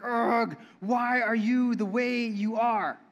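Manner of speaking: mock-exasperated groan, slowly drawn out; acted frustration, words dragged slowly. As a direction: groan it at an annoying post. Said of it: "feel my blood pressure start to rise".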